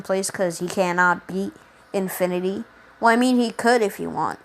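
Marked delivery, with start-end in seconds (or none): none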